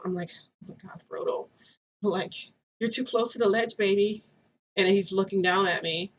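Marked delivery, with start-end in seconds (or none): 0.6-1.5 breathless whisper; hushed whisper of alarm at the sight; shocked whisper. Retold or imitated. Sees her child on the roof. 2.8-4.2 coaxing mom voice. Retold or imitated. Warning her baby near ledge.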